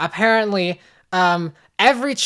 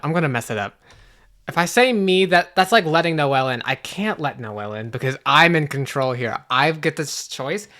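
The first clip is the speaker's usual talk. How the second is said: ordinary talk, nothing marked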